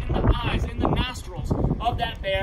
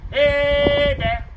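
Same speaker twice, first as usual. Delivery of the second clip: loud, higher, long-held HEY; loudest, proudest yell; 4 semitones higher, 9 dB louder than usual. Shown as a demonstration. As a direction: belt it out proudly to warn the bears. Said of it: "your loudest and proudest".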